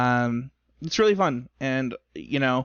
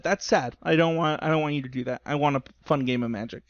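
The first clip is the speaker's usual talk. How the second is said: normal talking voice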